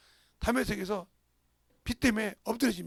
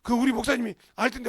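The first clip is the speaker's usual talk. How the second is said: loud, brushing-off retort; mock dismissive reply; 3 semitones higher, 9 dB louder than usual. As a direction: imitate a stubborn churchgoer. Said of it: "누가 와서 아무리 말한다 해도".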